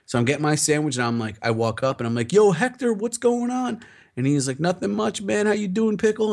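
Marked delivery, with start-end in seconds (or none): 2.3-3.8 high-pitched, hearty hello; retold chummy greeting, high and lively; upbeat greeting. Acted greeting to sandwich maker. 4.6-6.3 high-pitched friendly reply; retold buddy banter in a raised voice; chummy sandwich guy. Acts sandwich maker greeting back.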